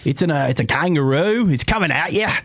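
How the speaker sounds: excited Aussie calling the kangaroo punch; imitating Aussie commentator. Mimicking the Aussie YouTuber calling a kangaroo fight, mild Australian accent. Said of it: "the Aussie".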